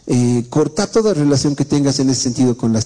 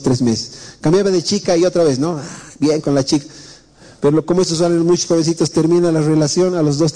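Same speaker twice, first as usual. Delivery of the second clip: ordinary delivery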